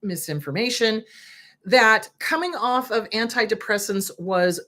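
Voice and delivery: normal talking voice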